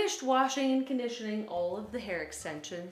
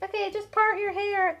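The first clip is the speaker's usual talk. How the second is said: high, airy tutorial host voice; mock the casual tutorial tone; breezy tutorial voice; 10 semitones higher than usual. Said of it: "They're like".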